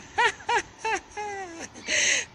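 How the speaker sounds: long, high-pitched laugh; hearty laugh. Real, not acted. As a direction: burst into drawn-out laughter. Laughs at a viewer's comment.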